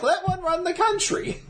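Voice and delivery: high squeak of disbelief; incredulous. Real, not acted. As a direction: voice jumps way up in disbelief. Incredulous punchline.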